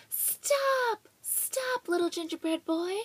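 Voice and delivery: high, loud chasing yell; shouting. Acted. Old couple running after the cookie, shouting loudly. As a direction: call him back at the top of your voice.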